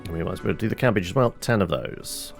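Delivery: ordinary talk, nothing marked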